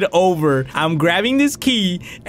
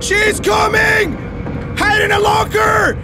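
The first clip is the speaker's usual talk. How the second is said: the monster is right behind you, scream it out; panicked shouting; 10 semitones higher, much slower than usual. Real, not acted. Fleeing the teacher in the game, panicked high shouts.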